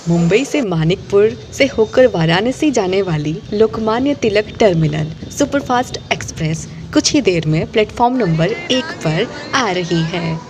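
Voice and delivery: usual speaking voice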